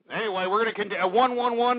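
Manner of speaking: normal talking voice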